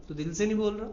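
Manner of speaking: ordinary talk, nothing marked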